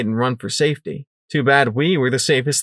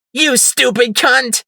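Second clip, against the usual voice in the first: slow, high, loud furious snarl; 13 semitones higher, 5 dB louder, slower than usual. Acted. Psycho Shy pouncing on Sunflower, snarling in rage. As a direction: spit the insult with rage. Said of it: "the yellow mare snarled".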